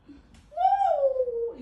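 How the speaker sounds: high, loud drawn-out "woo"; excited whoop. Real, not acted. Vlogger cheering their flipped-up hair, excited whoop. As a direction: let out a big high whoop. Reacting to hair volume flicked up.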